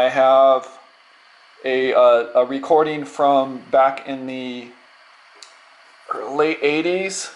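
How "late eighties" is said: ordinary talk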